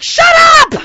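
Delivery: high, very loud outburst; exasperated scream. Retold or imitated. Mother yelling at her complaining child, exasperated. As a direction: shriek to silence the kid.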